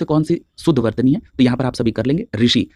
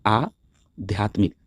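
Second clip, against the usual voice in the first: आध्यात्मिक slow and softer, long opening aa held apart; broken-down pronunciation; 4 semitones lower, 5 dB quieter, much slower than usual. Shown as a demonstration. Teacher spelling it out for students, आध्यात्मिक slowly in parts. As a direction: draw out आध्यात्मिक, aa first, then the rest. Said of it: "क्या होता है?".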